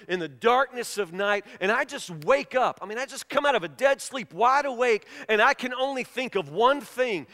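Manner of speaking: normal talking voice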